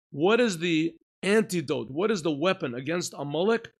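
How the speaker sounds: fervent sermon delivery; preaching. Acted. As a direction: preach it out to the room. Rousing the class.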